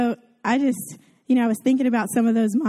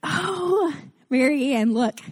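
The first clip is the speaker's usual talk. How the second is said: high, gushing mock awe over the cookies; gushing to a perfect mom about her cookies, high and breathless; gushing admirer; 10 semitones higher than usual. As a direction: fawn over her like a starstruck fan. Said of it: "And I'm like".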